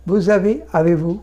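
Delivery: usual speaking voice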